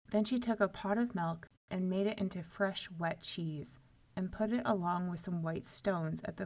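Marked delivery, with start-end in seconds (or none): none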